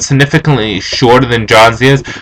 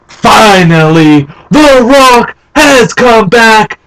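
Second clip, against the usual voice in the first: drawn-out wrestler catchphrase, pitched up; The Rock; 9 semitones higher, much slower than usual. Acted. Fan quoting The Rock's entrance line, big wrestler promo. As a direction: announce it slowly to a whole arena. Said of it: "The Rock would come out and he would say".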